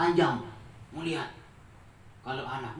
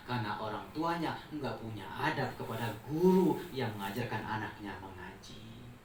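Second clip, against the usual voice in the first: ordinary talk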